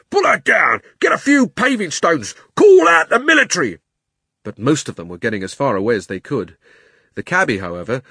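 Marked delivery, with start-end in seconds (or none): 0.1-3.8 high, loud mob shouts; angry crowd roar. A narrator's reading. Crowd calling for the witch to be pulled down, angry roar. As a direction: roar like an outraged crowd, building louder. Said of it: "an angry roar from the crowd".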